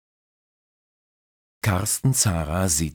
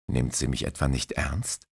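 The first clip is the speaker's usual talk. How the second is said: ordinary delivery, nothing marked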